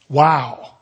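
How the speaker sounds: normal talking voice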